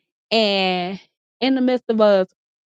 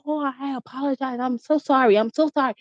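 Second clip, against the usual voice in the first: put-on apologetic boyfriend; 4 semitones higher than usual. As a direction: plead sorry in his voice. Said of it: "he's like".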